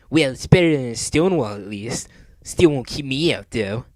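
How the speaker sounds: cocky cartoon hero sneer. A narrator's reading. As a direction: scoff at the wall. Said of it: "he sneered".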